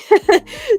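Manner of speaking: sudden high, loud laugh of embarrassment; embarrassed laugh. Real, not acted. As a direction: burst out laughing, sheepish. Awkward about asking for money.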